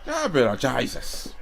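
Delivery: high, drawn-out mock-Irish cry; hammed-up Irish exclamation of a name; Irish accent. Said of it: "That's how that sounds".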